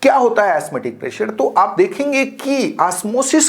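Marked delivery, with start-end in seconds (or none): none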